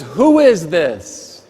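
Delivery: yelling into the phone at the chirpy maid; startled yell. Retold or imitated. A guest startled by the wake-up call yells back in a slow mock shout. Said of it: "he yells back into the receiver".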